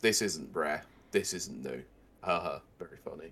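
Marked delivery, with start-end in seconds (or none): 2.2-3.3 lower, deadpan fake laugh; dry sarcasm. Real, not acted. Co-host answers a pun, dry sarcasm. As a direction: brush the joke off drily. Dry reply to co-host's joke.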